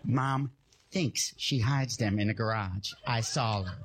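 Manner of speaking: very low, slow, deliberate reveal; conspiratorial little brother. Retold or imitated. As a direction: imitate a kid in on a big secret. Brother revealing Mom's hiding place.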